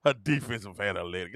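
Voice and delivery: low, soft mock lecture; condescending fan. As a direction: lecture like a smug stats nerd. Imitating fans' lecturing question.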